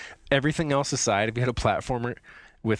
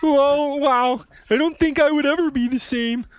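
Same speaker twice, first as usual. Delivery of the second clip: game master role; 13 semitones higher, 5 dB louder, slower than usual. Acted. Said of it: "I could be the G M".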